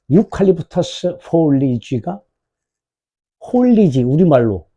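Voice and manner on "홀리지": "홀리지" called out high and loud; pronunciation demo. Shown as a demonstration. Teacher showing students how foliage is pronounced, emphatic demonstration. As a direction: sound out "홀리지" emphatically, pitched up. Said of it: "발음이 홀리지야".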